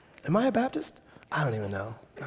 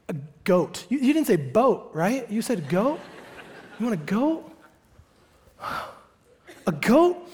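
high-pitched incredulous checking; bewildered dad; 4 semitones higher than usual. Acted. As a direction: repeat it as if you must have misheard. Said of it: "imagine the dad's like".

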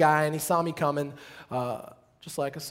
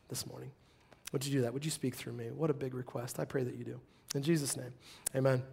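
soft, low, hushed prayer to God; quiet prayer; 6 semitones lower, 10 dB quieter than usual. Real, not acted. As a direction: pray gently, almost to yourself. Pastor prays before the sermon.

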